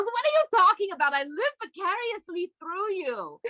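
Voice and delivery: a hint of an Israeli accent, teasing; Israeli mother. Boyfriend's Israeli mother teasing her on the phone, mock Israeli accent. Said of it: "She's Israeli".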